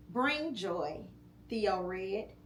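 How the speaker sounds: ordinary delivery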